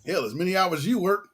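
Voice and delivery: usual speaking voice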